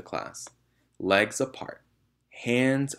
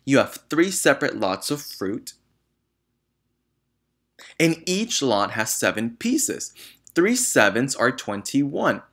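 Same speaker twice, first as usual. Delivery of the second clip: loud, high furious ranting; raging tirade; 5 semitones higher, 7 dB louder than usual. A narrator's reading. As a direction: rage at the foolish boy. Headmistress keeps raging at boy.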